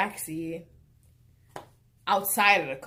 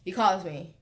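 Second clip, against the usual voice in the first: ordinary talk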